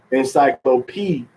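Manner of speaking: "encyclopedia" broken out at PE-di-a; third-to-last syllable stress. Shown as a demonstration. Teacher showing English stress, "encyclopedia" stressed on PE, syllables separated. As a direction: lean on PE in "encyclopedia". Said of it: "the third to the last syllable".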